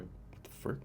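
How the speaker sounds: breathless whispered what the frick; shocked whisper. Retold or imitated. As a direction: whisper it under your breath, stunned. Stunned reaction to phantom voice.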